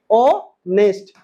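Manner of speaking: Honest stretched out very slowly, silent h; slow model. Shown as a demonstration. Teacher repeating honest slowly so students catch the silent h. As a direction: draw honest out syllable by syllable, starting on the o. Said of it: "क्या पढ़ते हैं?".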